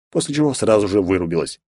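plain reading voice

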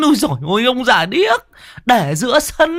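high pitched woman grumbling; exasperated woman. Acted. Mai grumbles at deaf old Cẩm, high exasperated woman.

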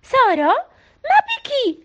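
very high astonished asking; surprised question. A narrator's reading. Mayor seeing Sara arrive, surprised question. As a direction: ask in amazement. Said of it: "سألها بتعجب".

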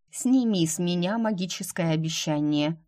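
ordinary delivery

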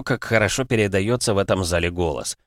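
usual speaking voice

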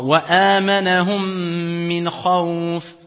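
amanahum min sung slowly, the two mims merged with a held ghunna; tajwid recitation. Shown as a demonstration. Teacher gives an example, slow sung recitation. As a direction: sing wa amanahum min khawf slowly, the mim nasal. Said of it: "Par exemple".

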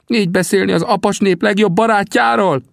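high, appalled protest; shocked, high-pitched reproach; indignant Sam. A narrator's reading. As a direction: object in a very high character voice. Sam indignant, claps hands.

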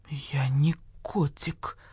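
slow, hushed, wounded protest; softly affronted, drawn out; offended. A narrator's reading. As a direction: say it breathy and deeply hurt. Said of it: "оскорбился барсукот".

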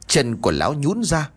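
ordinary delivery, nothing marked

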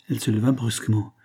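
ordinary delivery, nothing marked

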